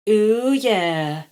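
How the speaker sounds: dance along with it; groovy dragon. A narrator's reading. Rascal dancing disco, grooving. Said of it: "she said".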